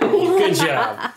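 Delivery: high squeaky laughing "good job"; laughing praise. Real, not acted. Laughing praise after correct guess.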